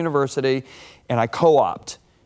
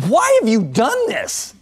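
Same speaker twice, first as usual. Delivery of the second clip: higher, louder, slow disbelief; incredulous question; 8 semitones higher, 4 dB louder, slower than usual. Retold or imitated. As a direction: ask it like you can't believe it. Shocked student questions veteran engineer.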